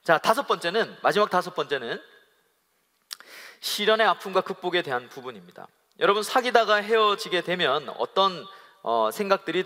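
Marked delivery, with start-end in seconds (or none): none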